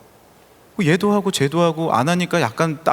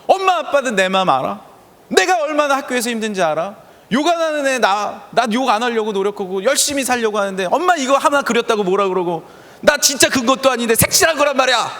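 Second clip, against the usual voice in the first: a daughter's mock protest, a little higher; imitating a hurt teenage girl talking back; lightly voiced upset daughter; 7 semitones higher than usual. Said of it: "속상하니까 이제 얘기한 거예요".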